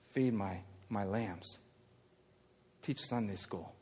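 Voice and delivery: very soft, low, measured charge; gentle instruction. Acted. Jesus telling Peter to feed his lambs, gentle. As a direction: give the task quietly and kindly.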